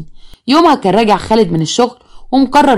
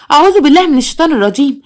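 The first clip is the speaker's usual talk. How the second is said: higher, more frantic repeat; rising shock; 6 semitones higher than usual. A narrator's reading. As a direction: say it again, panic building. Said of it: "قالت بصدمه". (استعاذة أعلى وأكثر ذعرا)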